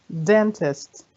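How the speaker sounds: "Dentist" as DEN-tist, falling at the end; stress on first syllable. Shown as a demonstration. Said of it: "هنا في أول مقطع صوتي بيكون قوي".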